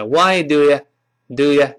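"do you" said as "d'ya"; slowed demo of "Why do you" ending in "ya"; weakened vowel. Shown as a demonstration. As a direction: reduce "you" to "ya". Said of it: "就变成".

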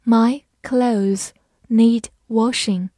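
slow, careful reading. Shown as a demonstration. Teacher modelling the sentence slowly for learners. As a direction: read each word slowly and clearly. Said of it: "好，我们慢慢来读。".

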